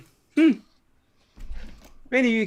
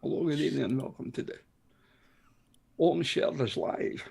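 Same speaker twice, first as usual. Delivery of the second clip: lower, softer Scottish burr in the style of Sean Connery; a quick jokey Sean Connery Scottish accent; 6 semitones lower, 7 dB quieter than usual. Retold or imitated. Host jokingly opens the show in a Sean Connery Scottish accent. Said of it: "I should have done it in his accent".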